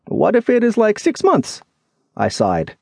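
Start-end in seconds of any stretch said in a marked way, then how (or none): 0.1-1.6 very high, questioning character voice; worried friend. Acted. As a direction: fret aloud in a high pitch. Lemmy worries about the wait.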